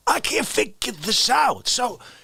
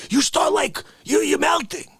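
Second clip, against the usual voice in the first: normal talking voice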